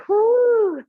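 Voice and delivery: high, loud whoop of effort; excited whoop. Real, not acted. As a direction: let out a happy woo after the hard set. Effort whoop during exercise.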